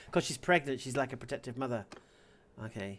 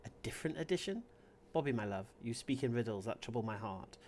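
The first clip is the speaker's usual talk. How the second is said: soft, wary question dropping lower; wary, subdued wife; low, hurt confusion; 4 semitones lower, 7 dB quieter than usual. A narrator's reading. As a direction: read it low and softly, lightly hurt. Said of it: "My voice is low, still carrying the edge of hurt".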